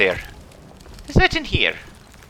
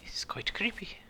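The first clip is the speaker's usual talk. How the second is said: hushed, creeped-out whisper; spooked whisper in the dark; 6 semitones higher, 14 dB quieter than usual. Real, not acted. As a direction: breathe it as if something might hear. Exploring a creepy place.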